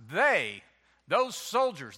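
usual speaking voice

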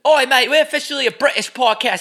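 loud, high put-on Cockney British accent; mock British accent. Mocking his co-host in a loud, high mock British accent. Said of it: "I'm the one with the British accent".

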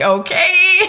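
half laugh, half cry of alarm; laughing panic. Retold or imitated. Mother told to push in ambulance, high laughing-crying okay.

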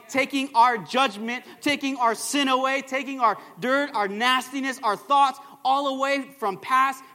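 loud, rousing sermon delivery; preacher building in punchy repeated phrases; full-force preaching. Real, not acted. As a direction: drive each phrase home to a congregation.

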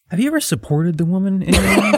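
mock a man bragging his big insight; hammed-up guy lecturing his friends; mock earnest guru. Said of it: "going to his guy friends the next day being like".

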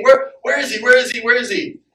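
put-on a parent who has lost a child, frantic; panicked search.

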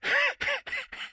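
bad smoker's laugh, cartoon dog; Muttley laugh. Shown as a demonstration. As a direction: cackle like a wheezing cartoon hound. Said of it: "It was kinda like that".

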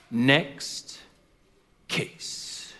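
low, very soft dismissal with a pause between words; dismissive judge. Acted. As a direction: wave her away quietly, as the judge. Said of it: "that judge would look right past her".

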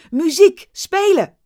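high, loud command; shouting. Acted. The owner shouts at the musicians to play. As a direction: yell to the band. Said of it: "Hij riep".

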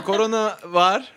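giggling through the words; trying not to laugh; stifled giggles. Real, not acted. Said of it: "Hiç gülmeyin ya".

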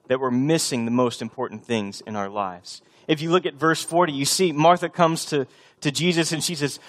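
usual speaking voice

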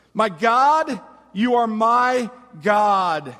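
loud, slow, emphatic declaration; cling to each word like an anchor; bold truth claim. Acted. David anchors himself to God, declaring it boldly. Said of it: "very bold and distinct truth claim".

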